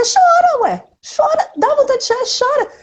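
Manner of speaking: high, insistent call to just cry; emphatic urging. Real, not acted. Urging listeners to let themselves cry, high and insistent. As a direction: push them: cry, go on!.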